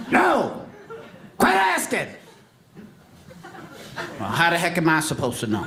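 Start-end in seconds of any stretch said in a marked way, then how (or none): 0.1-2.1 higher, curt fed-up dad; father answering the nagging kid, fed up; annoyed father. Pretend. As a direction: snap back like a tired dad.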